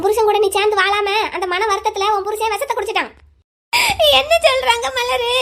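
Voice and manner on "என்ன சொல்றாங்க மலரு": tearful panic. Acted. Wife panics at the news.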